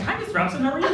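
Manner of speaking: high, lightly mimicked schoolboy politeness; schoolboy greeting. Boys greeting Miss Robson to get in her good books, mock-polite. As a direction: imitate boys buttering up a teacher. Boys sweet-talking their teacher.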